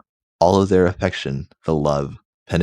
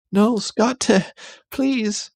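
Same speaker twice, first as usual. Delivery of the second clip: very high, begging mock falsetto; high pleading Jean; slower than usual. Jean begs Scott to stop, very high. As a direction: plead in a hammed-up squeak.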